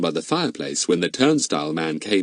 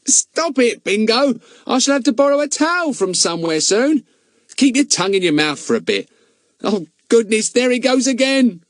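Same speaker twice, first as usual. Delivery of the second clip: put-on higher, louder boyish protest; boy Ern fending off dog; 4 dB louder than usual. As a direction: push the licking dog away laughing. Ern voiced, dog licking him.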